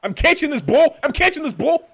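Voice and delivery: loud, high-pitched yelling; real gamer yell, repeated; excited shout. Real, not acted. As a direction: shout it out as the ball comes. Calling out during game play.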